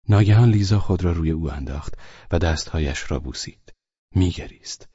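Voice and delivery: usual speaking voice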